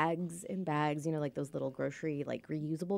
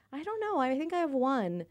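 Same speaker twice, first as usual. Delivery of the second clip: high-pitched, tentative retold answer; unsure reply; 8 semitones higher than usual. Acting out own unsure reply.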